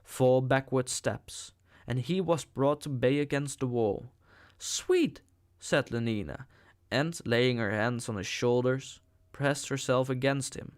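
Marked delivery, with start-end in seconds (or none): 4.6-5.2 cooing. A narrator's reading. Lenina cooing at the savage.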